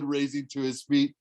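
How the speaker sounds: ordinary delivery, nothing marked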